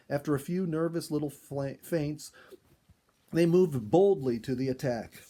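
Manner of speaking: ordinary delivery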